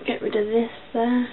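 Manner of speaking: ordinary talk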